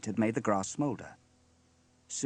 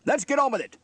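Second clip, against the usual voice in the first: high, loud stern command; 9 dB louder than usual. A narrator's reading. Steele orders the band to begin, stern. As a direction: order them briskly back to business. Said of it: "said Steele sternly".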